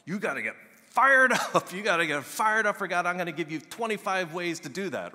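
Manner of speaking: high, loud fired-up preaching; mock the old pep-rally sermon, pumped and shouting; hyped-up preacher. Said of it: "I probably would have preached it".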